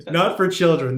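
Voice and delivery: loud, laughing disclaimer. Real, not acted. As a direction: call it out through laughter. Laughing disclaimer after innuendo.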